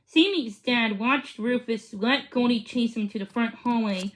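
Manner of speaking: ordinary delivery, nothing marked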